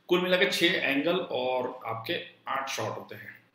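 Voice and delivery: usual speaking voice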